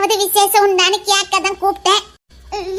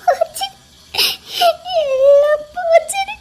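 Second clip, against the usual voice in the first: slow, high tearful wail; sobbing; 5 semitones higher, slower than usual. Acted. Wife wailing to her husband that all is lost, sobbing. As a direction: let it break into sobs.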